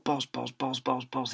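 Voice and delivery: teacher muttering balls under his breath. Acted. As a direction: mumble it to yourself. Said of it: "he said under his breath".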